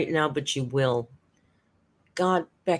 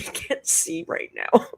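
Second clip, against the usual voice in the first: laughing; 13 semitones higher, slower than usual. Real, not acted. Laughing at own mishap.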